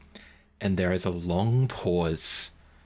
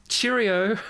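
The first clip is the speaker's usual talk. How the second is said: mock British cheerio; 13 semitones higher, 3 dB louder than usual. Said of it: "And she says".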